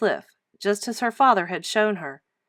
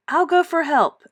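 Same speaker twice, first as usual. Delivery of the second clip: eager; 8 semitones higher, 5 dB louder than usual. Acted. Girl rushing for help.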